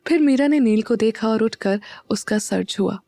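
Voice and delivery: plain reading voice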